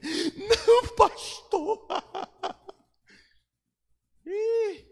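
high whining plea with a laugh; pleading member. Retold or imitated. Church member begging the pastor, high laughing whine.

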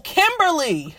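ordinary talk, nothing marked

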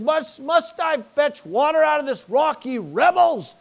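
high, loud, furious rebuke; Moses losing his temper. Retold or imitated. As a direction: roar at the rebels like an enraged prophet. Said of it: "Moses lost his temper".

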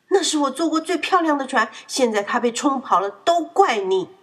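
loud, high angry shout, slowing on the blame; furious yell. A narrator's reading. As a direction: yell at his friend in rage. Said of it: "他冲着艾迪大吼着". (冲着艾迪大吼)

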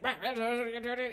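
mock angry jabbering; wordless comic ranting; imitated angry rant. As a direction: mimic a worked-up guy grumbling on. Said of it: "that has made this guy angry".

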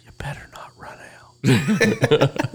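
slow, hushed whispered threat; whispered warning. Retold or imitated. Friend whispering a warning to Alex before walking out, hushed. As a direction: breathe it out as a half-laughing warning.

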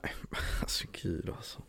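breathy laughing whisper. Real, not acted. Embarrassed by the joke, a laughing whisper.

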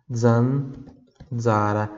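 Zanzara stretched slowly, zan-za-ra, each dz voiced; very slow syllables. Shown as a demonstration. Teacher slows Zanzara right down for students. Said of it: "como se fosse assim, ó".

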